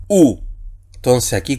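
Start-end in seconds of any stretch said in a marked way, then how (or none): 0.1-0.4 "U" as one pure oo vowel; single vowel sound. Shown as a demonstration. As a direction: say "U" as a single oo, no glide. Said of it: "ambas conforman un solo sonido vocálico".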